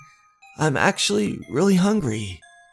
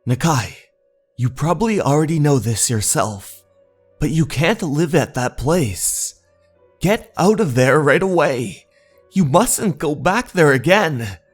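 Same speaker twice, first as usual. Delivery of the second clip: raised, insistent plea to leave; urgent warning; 5 semitones higher, 4 dB louder than usual. Acted. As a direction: warn a friend forcefully, pitch and volume climbing. Character urgently warns Nakai off the house.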